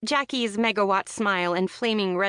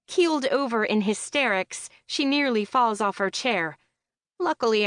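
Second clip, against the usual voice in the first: plain reading voice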